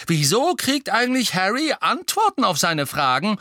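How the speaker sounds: high, loud angry complaint. A narrator's reading. Twin angrily asking why Harry gets answers. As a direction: demand it furiously. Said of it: "sagte Fred wütend".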